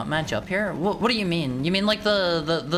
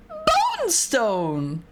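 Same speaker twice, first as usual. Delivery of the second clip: high, drawn-out call; playful high exclamation; mock hype shout; 10 semitones higher, 3 dB louder than usual. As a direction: belt it out like a game catchphrase. Calls out game move in mock hype.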